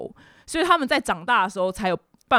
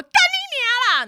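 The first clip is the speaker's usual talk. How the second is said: high screeched swear; mock furious cursing; 4 dB louder than usual. Regina curses out the friend, mock screaming abuse. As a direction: imitate her exploding into abuse. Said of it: "居然还就大骂我".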